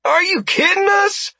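loud, high exasperated yell; frustrated shout. A narrator's reading. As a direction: shout it out in disbelief. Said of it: "threw her arms up in frustration".

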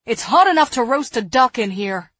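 loud, exasperated complaint; snapping. A narrator's reading. Woman in stifling sickroom, loud exasperated snapping. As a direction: snap loudly about the heat. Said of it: "Eliza snapped".

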